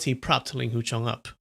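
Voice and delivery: ordinary delivery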